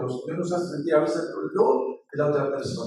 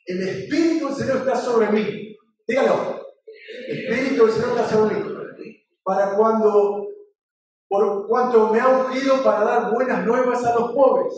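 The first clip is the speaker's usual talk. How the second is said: raised, loud preacher's declamation; scripture recitation; 4 semitones higher, 4 dB louder than usual. Acted. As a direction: proclaim it phrase by phrase for the congregation to repeat.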